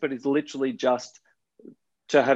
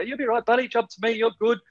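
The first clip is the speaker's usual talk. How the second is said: put-on higher, encouraging voice for a small child; reassuring; 9 semitones higher than usual. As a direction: coax a scared kid to jump. Coaxing the boy to jump.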